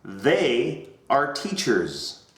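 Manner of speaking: They are teachers, slow, stress on they; slow model sentence. Shown as a demonstration. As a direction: model it slowly for the class to repeat. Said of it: "Everybody repeat that".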